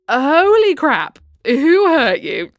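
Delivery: loud, slow, stunned disbelief; shocked outburst. Real, not acted. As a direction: blurt it out, floored by the insult. Shocked reaction to rude message.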